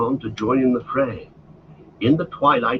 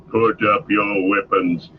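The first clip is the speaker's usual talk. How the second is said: a hint of an orc chief halting a brawl; Ugluk shouting; 3 semitones lower than usual. A narrator's reading. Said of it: "shouted Ugluk".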